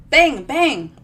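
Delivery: loud punchy bang bang; spoken gunshots. Acted. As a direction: fire the words off like shots. Gunshots in the story.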